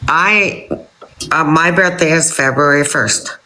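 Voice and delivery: ordinary delivery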